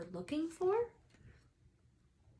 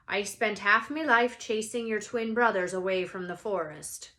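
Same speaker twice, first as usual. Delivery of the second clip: louder rustic giant; a touch of Hagrid's country accent; 8 dB louder than usual. Acted. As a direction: grumble it fondly. Hagrid voiced in story.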